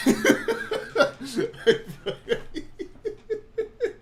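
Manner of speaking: long high cackle; wild high laughter; high cackling laugh. Real, not acted. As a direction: burst out cackling. Laughing at own German.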